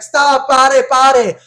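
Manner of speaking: high, loud plea to halt; retold screaming. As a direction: shout it across the water. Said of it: "They're screaming it".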